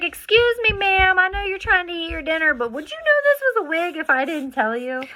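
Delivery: hammed-up polite Southern asking; sweet polite asking. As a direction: charm a stranger at dinner. Retold asking diners about wig.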